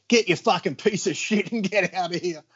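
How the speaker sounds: imitating a boss kicking out a salesman; mock angry dismissal. Joe throwing out salesman.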